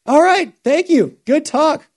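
high, loud mock-chipper goodbye; cheery phone sign-off. Bob happily ends the phone call.

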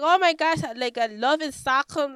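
high, loud, playful gush; mock excited gushing. Coach imitating a thrilled French audience, mock excited gushing.

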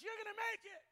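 imitated yell. Preacher acting someone encouraging us, imitated yell. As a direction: call it out like someone cheering us on. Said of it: "When somebody yells at us".

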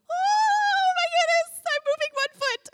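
very high, loud comic squeal; hammed-up alarm, starting on a long squeal; mock nervous panic. Timid worshipper moving one foot, mock-panicked squeal. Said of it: "I'm feeling very nervous".

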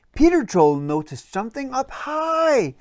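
ordinary delivery

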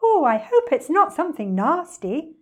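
high, lilting worry; singsong bird. A narrator's reading. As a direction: sing it out innocently like a bird. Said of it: "sang the roly-poly bird".

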